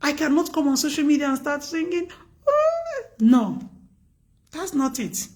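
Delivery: ordinary talk, nothing marked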